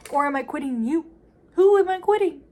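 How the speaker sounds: building to a high, loud shout; hammed-up shouted challenge; heated confrontation. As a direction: act out a dramatic demand, escalating.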